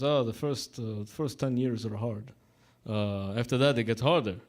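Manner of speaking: lightly imitated Russian-Italian accent; halting mock Russian-accented English. Retelling a Russian barber's wedding joke, mock Russian accent. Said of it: "he's got this Russian accent".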